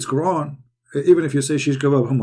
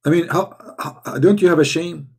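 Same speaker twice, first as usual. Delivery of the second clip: ordinary delivery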